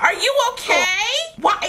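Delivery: ordinary delivery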